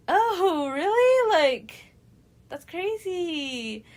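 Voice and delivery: high, loud fake gasp of surprise that slows to a drag on crazy; mock shock. [name] hearing the cheating news, hammed-up high surprise. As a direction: ham up the fake disbelief.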